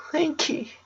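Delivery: put-on high, pleading girl voice between sobs; sobbing older sister. A narrator's reading. Older sister sobbing to her sick brother, high and pleading. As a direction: beg him through tears, way up high. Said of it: "speak to Lincoln between sobs".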